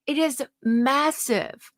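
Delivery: slow, drawn out "It is massive"; a slow, awed "It is massive"; stressed massive. Real, not acted. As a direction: stretch the words "It is massive" for emphasis. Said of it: "emphasizing the barrel size".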